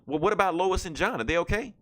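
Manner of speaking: high, anxious question; worried Supergirl. Retold or imitated. Supergirl asking Superman about Lois and John, high and worried.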